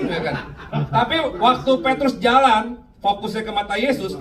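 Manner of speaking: ordinary delivery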